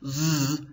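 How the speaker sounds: "z" as a voiced buzz; buzzing sound. Shown as a demonstration. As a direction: buzz the "z" on its own. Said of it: "a sort of buzzing or hissing noise".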